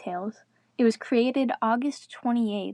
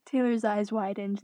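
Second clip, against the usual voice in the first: ordinary delivery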